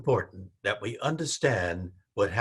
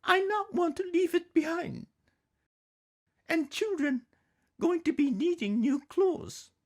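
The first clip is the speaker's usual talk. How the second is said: much higher, firm mother's voice with broken English; Mama's voice. Acted. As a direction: insist gently like a determined mother. Mama defends bringing sewing machine.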